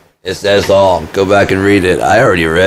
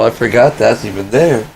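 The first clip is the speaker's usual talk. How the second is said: ordinary talk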